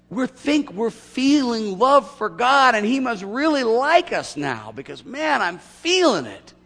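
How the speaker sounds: high, loud, stretched-out feel-good voice; mock gushing believer. Pastor parodying churchgoers who think they feel it, slow and gushing. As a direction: overplay the rush of feeling close to God.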